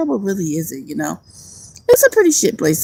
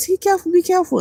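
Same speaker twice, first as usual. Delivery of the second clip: much higher, imitating fussy friends; mock worried warning; 12 semitones higher, faster than usual. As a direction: squeak it like nagging friends. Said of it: "they be like".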